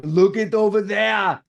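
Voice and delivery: high, loud action-hero voice; Schwarzenegger impression. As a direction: do Arnold as the villain. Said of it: "And you get Schwarzenegger".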